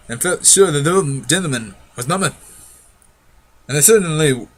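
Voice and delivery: ordinary delivery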